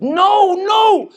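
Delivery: high, loud panicked no; panicked shout. Retold or imitated. Sick friend shrieking at the idea of an ambulance. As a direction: yell it in high-pitched alarm. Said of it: "and he said".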